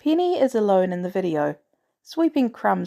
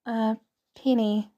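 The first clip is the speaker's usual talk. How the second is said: hesitant; 3 semitones higher than usual. A narrator's reading. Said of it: "Penny answers hesitantly".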